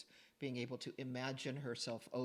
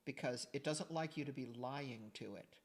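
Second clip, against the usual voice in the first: ordinary talk, nothing marked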